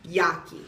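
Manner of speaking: Yaki with a Y sound for the J; Yaki, the J turned into Y; Spanish pronunciation. Shown as a demonstration. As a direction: say Jackie as Yaki. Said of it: "In Spanish, I might be pronounced".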